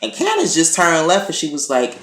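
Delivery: usual speaking voice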